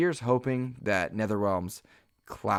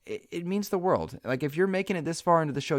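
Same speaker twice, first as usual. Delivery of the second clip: heartfelt, pitched well above usual; a little emotional; 9 semitones higher than usual. Real, not acted. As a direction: let real emotion lift the voice high. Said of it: "getting a little emotional here".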